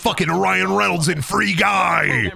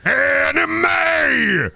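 excited shout; 9 semitones higher than usual. Real, not acted. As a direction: blurt it out at the top of your voice. Excited outburst at game cutscene.

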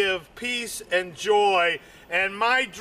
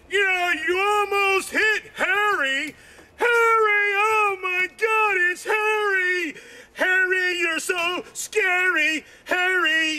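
high, frantic yelling of Harry's name; panicked shout, as if spotting him on camera; mock frantic cop yelling Harry; police shouting on the phone; 10 semitones higher than usual.

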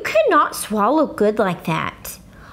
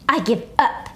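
grouchy. A narrator's reading. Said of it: "he grouched".

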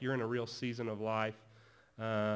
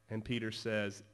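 normal talking voice

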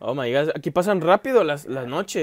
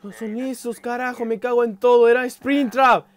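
high-pitched rattled outburst, building louder; panicked cursing; 6 semitones higher, 3 dB louder than usual. Real, not acted. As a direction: curse in fright after a scare. Reacting after the jump scare.

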